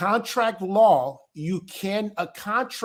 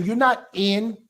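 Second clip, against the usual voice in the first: normal talking voice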